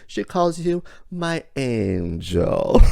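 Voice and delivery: slow, high and gentle; tender reveal. Retold or imitated. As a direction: savor each word like a secret gift. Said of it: "She says".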